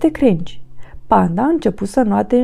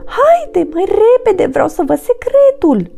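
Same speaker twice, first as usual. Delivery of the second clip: high, eager little monkey; impatient urging; 10 semitones higher, 3 dB louder than usual. Acted. Monkey hurries Panda to see the secret, high and impatient. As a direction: hurry your friend along in a high, excited voice. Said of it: "pentru a-l face să se grăbească".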